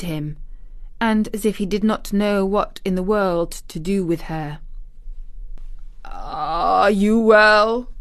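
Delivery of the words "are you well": very slow, drawn-out question; troubled guardian. A narrator's reading. Guardian checking on his young ward, slow and awkward. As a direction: ask it haltingly, stretching each word.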